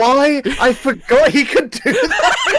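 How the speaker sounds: high-pitched helpless laughing. Real, not acted. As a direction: talk through a laughing fit. Cracking up at forgotten ability.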